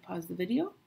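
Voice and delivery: ordinary talk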